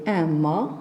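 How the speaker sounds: low "mât" ending on the vowel; silent final T. Shown as a demonstration. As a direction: leave the T of mât unsounded. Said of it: "on ne prononce pas". (mât avec le T muet)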